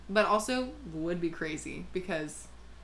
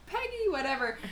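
high, sing-out greeting; imitated delighted greeting; 10 semitones higher than usual. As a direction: imitate a cousin gushing hello. Cousin greeting grandma in story.

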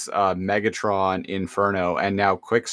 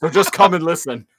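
higher, louder menacing ant soldier; Inferno; 8 semitones higher, 5 dB louder than usual. Retold or imitated. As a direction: threaten like a zealous bug warrior. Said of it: "Inferno is all like".

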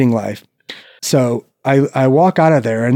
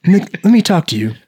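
1 shady recruiter; 7 semitones higher than usual. Retold or imitated. Army recruiter calls him over like a smack dealer. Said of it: "And he's like".

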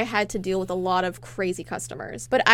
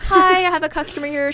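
higher, louder put-on cheery call; calling the manager; 6 semitones higher, 5 dB louder than usual. Cashier radioing her manager about a customer, retold polite call-out. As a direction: pipe up over the walkie-talkie.

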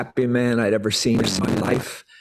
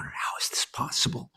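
unvoiced, breathless wonder; awed whisper; 10 dB quieter than usual. Amazed by a happy driver, he whispers in wonder. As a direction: barely breathe it out in awe. Retold awe at the vision.

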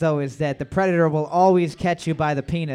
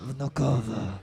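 hint of a Russian accent; 6 semitones lower, 6 dB quieter than usual. Shown as a demonstration. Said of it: "No, that was Spanish again".

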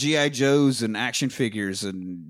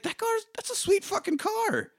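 pitched way up in awe; high excited quote; 4 dB quieter than usual. Retold or imitated. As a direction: squeal about the car. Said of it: "I'm like".

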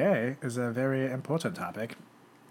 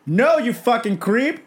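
angry yell; 10 semitones higher, 8 dB louder, slower than usual. Retold or imitated. Parent rejecting again.

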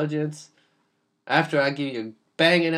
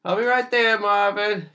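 normal talking voice

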